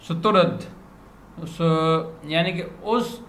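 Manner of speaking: usual speaking voice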